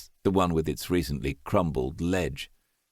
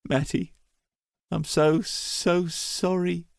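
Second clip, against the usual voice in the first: higher, breaking, slow on sorry; grieving apology; 9 semitones higher than usual. A narrator's reading. Stranded brother apologizes to dead Matty, grieving. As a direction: ache through the apology.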